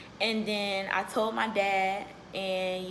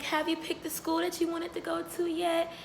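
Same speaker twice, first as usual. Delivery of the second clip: higher-pitched mom voice; lightly voiced mom, pitched up; imitating mom's question; 7 semitones higher than usual. Mom asking her daughter about school, imitated in a higher voice.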